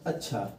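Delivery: अच्छा low and soft, falling at the end; plain statement. Shown as a demonstration. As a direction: say अच्छा as a quiet, settled statement. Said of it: "सामान्य एक कथन है".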